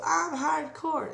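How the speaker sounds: Mick Foley voice. Retold or imitated. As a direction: stretch I'm hardcore like a proud goof. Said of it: "in that exact voice".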